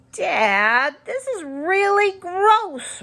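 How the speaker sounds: a daughter pleading with her gross dad; disgusted. A narrator's reading. Daughter complains to dad about the doll, high and slow.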